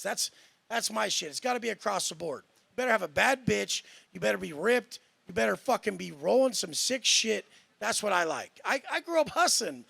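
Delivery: ordinary talk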